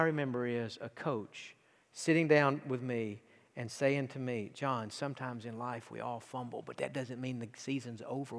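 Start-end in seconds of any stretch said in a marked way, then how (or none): 4.5-8.4 tender coaching words. Retold or imitated. Recalling coach's comforting words.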